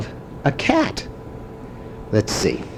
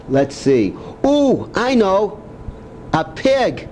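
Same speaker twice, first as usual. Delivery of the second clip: normal talking voice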